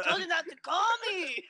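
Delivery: high-pitched exasperated phone rant; mock angry dad. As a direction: act out an irritated jailed father. Plays dad taking collect call.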